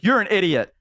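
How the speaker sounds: high exasperated snap; scolding friend. Retold or imitated. Elf friend bursting in to scold wounded Walter, high. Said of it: "she was like".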